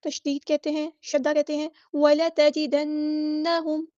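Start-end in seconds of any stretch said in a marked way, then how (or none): 1.9-3.9 وَلَتَجِدَنَّهُمْ with the نّ held in ghunna one alif long; slow tajweed reading of وَلَتَجِدَنَّهُمْ; Quranic recitation. Shown as a demonstration. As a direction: chant وَلَتَجِدَنَّهُمْ slowly, humming through the doubled نّ. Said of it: "ایک الف کے برابر رکتے ہیں".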